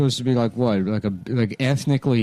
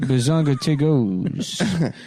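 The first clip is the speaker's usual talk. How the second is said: slow drawn-out name; much slower than usual. Acted. Joking about a name, drawn out very slowly. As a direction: stretch out Bazonga Tigos, very slow. Savouring silly name, slowed.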